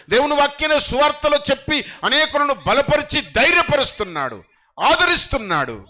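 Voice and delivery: rising sermon cry; full-force preaching. Acted. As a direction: proclaim it to the whole hall.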